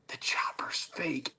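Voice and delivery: slow, voiceless whisper; whispered confession. Retold or imitated. Hosts breaking the helicopter secret to a fan, whispered.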